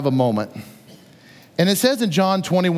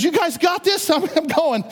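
eager rush; 10 semitones higher than usual. Retold or imitated. Peter jumps in to swim.

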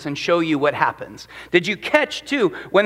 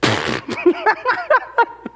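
amused laugh; 9 semitones higher than usual. Acted. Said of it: "He sits and he laughs".

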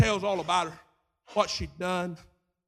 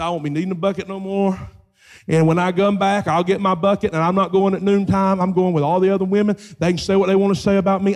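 loud, fast, bold and free; defiant declaration; 12 dB louder, faster than usual. Acted. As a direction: shout it out, done caring. Freed woman declares defiance.

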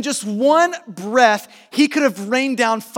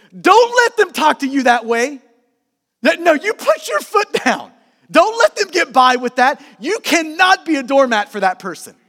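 louder, higher voice of worldly advice; indignant urging; 3 semitones higher, 4 dB louder than usual. Acted. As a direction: urge a friend hard to stand up for themselves. World's defiant advice, acted.

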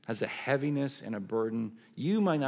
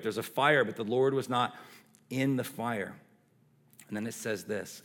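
ordinary delivery

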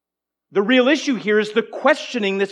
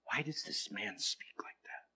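hushed, muttered scribe's grumble; murmuring; 10 semitones lower, 21 dB quieter than usual. Retold or imitated. Scribes grumbling to each other about Jesus, murmured. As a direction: whisper it to yourself in the corner. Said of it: "Murmuring in their hearts".

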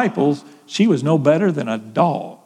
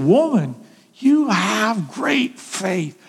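tender praise; 3 semitones higher, much slower than usual. Acted. Jesus praises the woman's faith.

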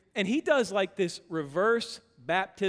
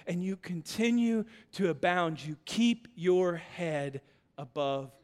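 slow, softer preaching; soft closing sermon; 4 semitones lower, 4 dB quieter than usual. Acted. Pastor closing the message, slow and soft. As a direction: bring it down gently.

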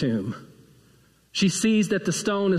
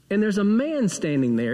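ordinary delivery

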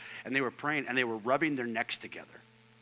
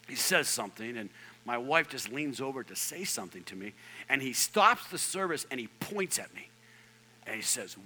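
ordinary talk, nothing marked

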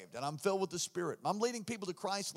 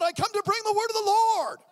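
high, loud proclaiming voice; spirit-filled messenger; 13 semitones higher, 10 dB louder than usual. Retold or imitated. Preacher proclaiming to police, loud spirit-filled declaration. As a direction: announce it like a prophet. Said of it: "I'm like, filled with the Spirit".

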